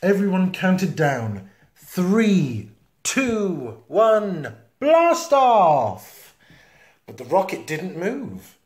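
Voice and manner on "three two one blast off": rising to a loud blast off; building countdown. Acted. Everyone counting down to launch, building excitement. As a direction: count down, climbing higher each number.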